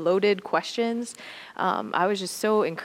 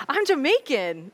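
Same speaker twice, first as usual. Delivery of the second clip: Cece's voice; 7 semitones higher, 4 dB louder than usual. Retold or imitated. Cece states origin.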